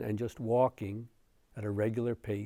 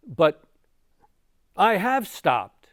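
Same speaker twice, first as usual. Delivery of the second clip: loud, raised, baffled protest; confused protest; 8 semitones higher, 11 dB louder, slower than usual. Acted. Confused Angulimala answers the Buddha, baffled protest. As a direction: protest in bafflement, higher and louder. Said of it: "he's now very confused".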